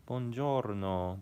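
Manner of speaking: slow, drawn-out Italian greeting; exaggerated Italian pronunciation; Italian accent. Shown as a demonstration. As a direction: demonstrate an Italian accent, stretching the word. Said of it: "that's Italian".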